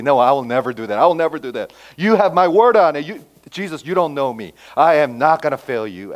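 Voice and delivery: loud retold promise, pressing each repeat; insistent vow. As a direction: insist over any objection, acting out the disciple. Acted insistent vow of loyalty.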